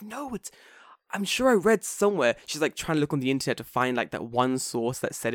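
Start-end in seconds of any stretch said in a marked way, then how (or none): 0.0-2.3 insistent teacher impression. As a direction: imitate someone searching for proof, voice lifted high. Voices teacher doubting the dictionary.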